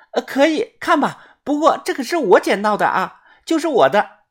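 high, put-on girl's voice; high-voiced insistence on ownership; possessive young woman. A narrator's reading. Girl staking claim to her find.

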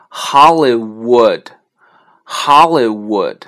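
loud "Hollywood" drawn out syllable by syllable; slow word demo. Shown as a demonstration. Teacher modelling Hollywood for learners, loud slow demonstration. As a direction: stretch "Hollywood", with a full oo glide in wood. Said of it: "如果W在单词的中间呢".

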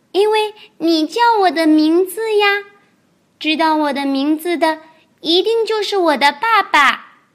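高高的童声，天真认真; 小孩一本正经地解释; 天真的小恐龙. Acted. 小恐龙角色对白. (天真的高音童声)

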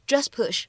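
"just push" run together, the t in "just" dropped; lost plosion. Shown as a demonstration. Teacher demonstrates linking to learners, "just push" with no released t. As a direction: drop the t of "just" straight into "push". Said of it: "失去爆破的处理".

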